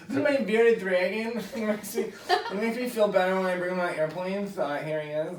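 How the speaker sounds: mocking a pet owner at the airport; mock lizard owner. Mocking bearded dragon owner.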